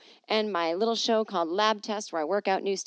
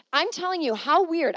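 higher, louder amazement; amazed; 9 semitones higher, 7 dB louder than usual. Real, not acted. Marvelling at an email about French. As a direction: marvel at the coincidence.

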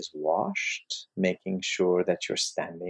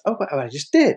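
mild mock protest, raised and louder; 5 semitones higher, 5 dB louder, faster than usual. Man answering Rumi's correction, lightly acted protest. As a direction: protest as the man who thinks he complied. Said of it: "the man protests and says".

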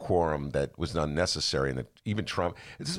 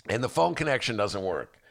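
ordinary talk, nothing marked

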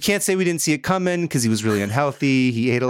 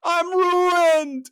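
much higher, wailing voice of a ruined man; crying cheesemonger. Retold or imitated. Billy Bob tells Ray on the phone he is ruined, high mock crying. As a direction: play the sobbing friend, pitched way up. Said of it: "Billy Bob cries on the phone".

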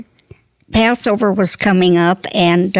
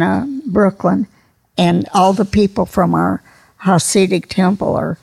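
ordinary talk, nothing marked

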